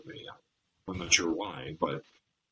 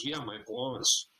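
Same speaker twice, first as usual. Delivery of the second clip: put-on higher and slower, a touch of tearful girlfriend; tearful confirmation; 13 semitones higher, 4 dB quieter, slower than usual. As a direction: confirm it in a small hurt voice. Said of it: "And she says".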